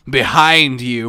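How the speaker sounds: slow, loud creepy call. Retold or imitated. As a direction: say it like a lurking ghoul. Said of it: "a little bit creepy".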